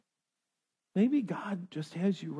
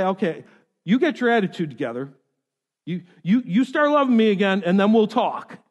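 mock scolding; 2 semitones higher, 7 dB louder than usual. God imagined scolding Elijah, mock.

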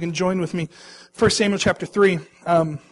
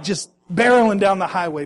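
normal talking voice

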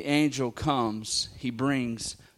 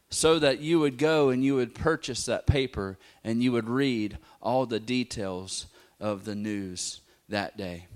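normal talking voice

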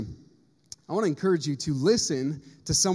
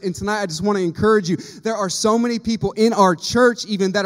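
higher and louder preacher's exhortation; preaching at full force; 5 semitones higher, 8 dB louder than usual. Acted. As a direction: drive it home to the congregation. Preaching climax, urging church.

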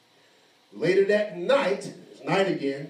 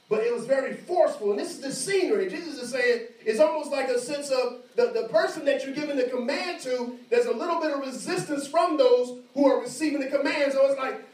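high, loud pulpit voice, climbing; full-force preaching; 11 semitones higher, 6 dB louder than usual. Acted. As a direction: preach the disciples' protest to the room.

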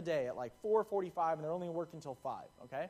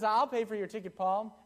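bright, louder, higher mock offer; generous offer; 6 semitones higher, 7 dB louder than usual. Kyle offers to pay Paul's ticket, upbeat. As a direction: cheerfully offer to cover it. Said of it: "he says".

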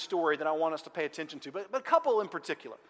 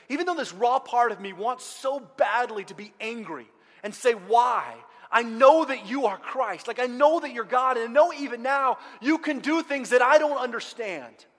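loud, higher, building plea; impassioned declaration of faith; 6 semitones higher, 8 dB louder than usual. Acted. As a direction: insist on faith through raw hurt. Voices Martha's raw, fervent faith.

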